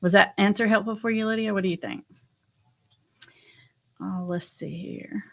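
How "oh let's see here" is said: slow, breathy whisper while scanning; soft whispered aside; whispered to herself. Real, not acted. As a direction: whisper it as you look for the next one.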